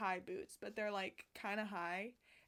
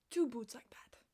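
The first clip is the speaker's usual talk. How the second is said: unvoiced awed whisper. Real, not acted. Vlogger whispering in awe about boots. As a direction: drop to a whisper.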